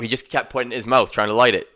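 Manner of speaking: normal talking voice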